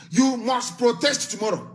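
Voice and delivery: ordinary delivery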